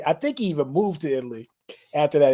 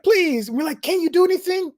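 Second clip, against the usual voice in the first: pleading. Retold or imitated. Friends begging the guide.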